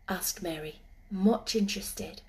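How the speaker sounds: ordinary delivery, nothing marked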